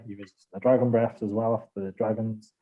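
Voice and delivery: normal talking voice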